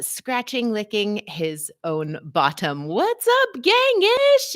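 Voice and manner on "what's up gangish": excited greeting. Real, not acted. Greeting a viewer on live stream.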